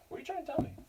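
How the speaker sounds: high soft cooing question; baby talk to dog. Acted. Teacher asks her chatty dog, high soft pet voice. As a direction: ask the dog sweetly, way up high.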